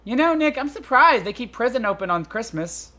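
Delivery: high-pitched, musing assistant voice; lightly wondering in a high voice; chatty young assistant, Maya. Acted. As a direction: pitch it up, idly surprised.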